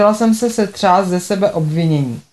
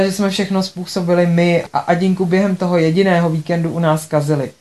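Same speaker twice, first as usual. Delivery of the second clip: ordinary delivery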